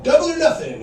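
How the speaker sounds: high, loud gambling devil; gleeful devil. Pretend. Devil betting God over Job, loud high glee. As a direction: raise the stakes like a gleeful gambler.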